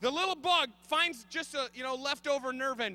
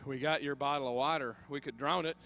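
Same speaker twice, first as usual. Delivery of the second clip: lower suggestion; 12 semitones lower, 5 dB quieter than usual. Retold or imitated. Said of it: "she said".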